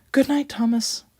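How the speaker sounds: a cheerful little engine; Quincy voice. A narrator's reading.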